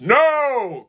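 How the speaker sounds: loud, high emphatic refusal. A narrator's reading. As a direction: bellow the refusal. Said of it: "Gordon repeated with feeling".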